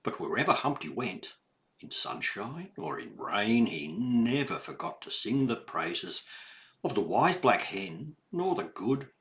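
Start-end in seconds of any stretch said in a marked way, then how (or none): none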